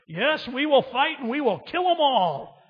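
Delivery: rally the troops with a mock roar; mock battle cry. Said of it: "an Irish Reserve officer".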